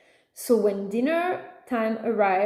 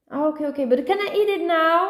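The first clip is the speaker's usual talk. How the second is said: lightly voiced pestering kid, higher; impatient child; 6 semitones higher, 4 dB louder, faster than usual. Son pestering mom for his chocolate egg, impatient child. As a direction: ask eagerly in a higher kid voice.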